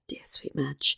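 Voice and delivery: hushed, tiny fond whisper; whispered aside. Real, not acted. Podcaster slips in an aside on the lady-in-waiting, whispered.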